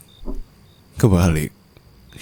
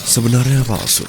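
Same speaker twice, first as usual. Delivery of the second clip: higher and louder, punching sebenarnya; triumphant reveal; 8 semitones higher, 6 dB louder than usual. Acted. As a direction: drop the bombshell. Revealing the fake will.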